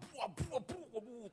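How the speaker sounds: high mock child calling buah; high childlike buah; child's voice. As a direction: squeal it like a greedy kid. Mimicking child grabbing fruit.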